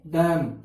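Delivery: डम with a short half 'a' sound, slow; half-a vowel dum. Shown as a demonstration. Teacher showing students the short vowel in डम. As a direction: say डम with just half an a. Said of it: "आधा ए".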